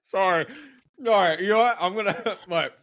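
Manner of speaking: laughing while chewing; mouth full. Real, not acted. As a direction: talk through a mouthful of candy. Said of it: "Is [name] eating into the microphone?".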